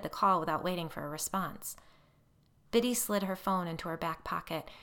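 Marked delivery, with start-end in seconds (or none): none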